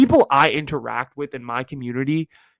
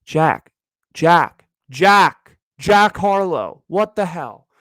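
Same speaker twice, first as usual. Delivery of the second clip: each Jack louder, drawn out; calling out; 2 semitones higher, 7 dB louder, much slower than usual. Pretend. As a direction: call for Jack across a room.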